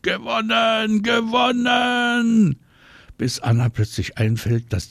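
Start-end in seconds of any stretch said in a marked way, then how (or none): 0.0-2.6 high, drawn-out triumphant shout; long, high yell of triumph; very loud scream. A narrator's reading. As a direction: scream the victory out, stretching each word. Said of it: "schreien sie ganz, ganz laut".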